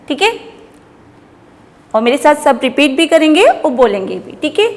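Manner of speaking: usual speaking voice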